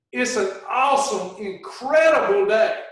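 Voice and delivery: ordinary talk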